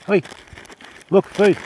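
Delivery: ordinary delivery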